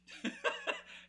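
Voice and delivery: high burst of real laughter; loud laughing burst at the memory. Real, not acted. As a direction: let a genuine laugh out. Laughs recalling the prank.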